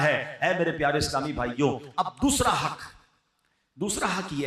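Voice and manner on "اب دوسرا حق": normal talking voice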